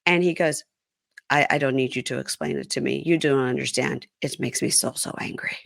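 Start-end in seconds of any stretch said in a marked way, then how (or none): 1.3-5.6 low, hushed husband impression, fading away; imitating restrained anger. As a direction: let the retold anger sink quieter and quieter. Voicing husband's restrained anger in quarrel.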